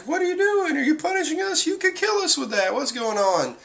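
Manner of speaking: squeaky falsetto mock alarm; mock bewildered panic. G-5 men pleading with their attacker, mock bewildered panic. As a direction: protest in a very high put-on voice.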